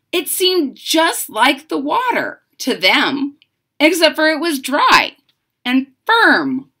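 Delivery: put-on lively read-aloud voice for children; story narration. A narrator's reading. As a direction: read it like a bedtime picture book. Reads the picture book aloud.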